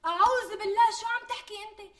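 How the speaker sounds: high, loud shocked recoil; horrified gasp. Acted. Fiancée horrified when groom mentions stealing, high shocked cry. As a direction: recoil at the very idea.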